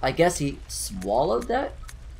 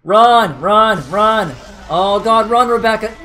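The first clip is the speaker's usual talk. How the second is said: being chased; 7 semitones higher, 8 dB louder than usual. Real, not acted. Fleeing a monster in game.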